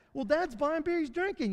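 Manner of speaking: a hint of children gossiping about dad; kids' voice. Imagined kids judging dad.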